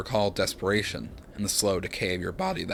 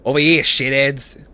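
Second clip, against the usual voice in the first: loud, slow Aussie bellow at the guards; slow, loud taunting yell; 5 semitones higher, 10 dB louder than usual. Acted. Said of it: "he bellows to the guards".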